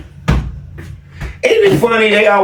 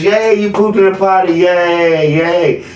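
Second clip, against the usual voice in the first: potty training praise; 2 semitones higher, slower than usual. Retold or imitated. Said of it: "y'all come in here and tell me".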